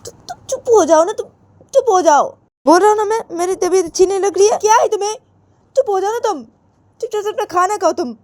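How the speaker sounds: high, loud scolding from an unwell father; irritated father. Acted. Father silencing his squabbling family at dinner, irritated.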